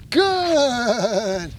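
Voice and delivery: GOOD stretched long and falling; drawn-out duration marker. Real, not acted. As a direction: hold "good" out slowly to the dog. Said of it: "Duration marker".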